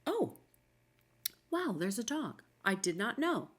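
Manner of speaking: light, airy mock surprise on Oh; a hint of a shopper noticing a dog late; pretend surprise. Shopper spotting a quiet dog, pretend mild surprise. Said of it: "it should be like".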